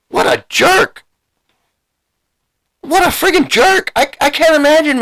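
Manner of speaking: high, exasperated exclamation; indignant. Real, not acted. Reacting to the husband's insults, a high indignant exclamation. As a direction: squeal it out way up high in disbelief.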